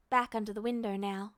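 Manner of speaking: usual speaking voice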